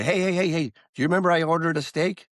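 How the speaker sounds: high-pitched impatient diner, played for effect; acted insistent interruption, pitched well up; mock pestering. Acts a diner pestering the waiter.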